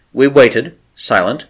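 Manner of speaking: ordinary delivery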